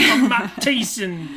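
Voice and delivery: lightly put-on British accent. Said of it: "My British accent's worse".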